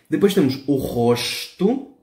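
slow model word rosto, guttural R; Portuguese demo. Shown as a demonstration. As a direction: say "rosto" with R from the throat, slowly. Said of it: "suena desde la garganta".

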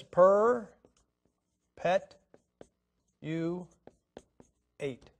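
"Per pet you eight" as four slow separate syllables with pauses between; broken into syllables. Shown as a demonstration. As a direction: chop "Per pet you eight" into four drawn-out beats to mock its length. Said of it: "to one four syllable word".

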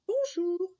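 put-on high-pitched child's hello; little boy. Jacques greets the strange old man back, high boyish voice. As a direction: answer as a small boy, high up.

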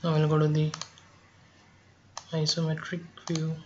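normal talking voice

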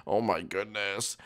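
ordinary delivery

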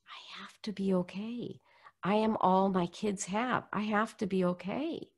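hushed, urgent self-talk; whispering to yourself to hold on; desperate whisper. Retold or imitated. Desperate inner thought.

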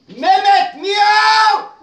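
high loud shout of Mehmet, meow; shouted cue. Acted.

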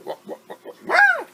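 imitated whiny wah-wah crying, rising to a high wail; whimpering wah then a high wailing wah; mock crying. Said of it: "She's like".

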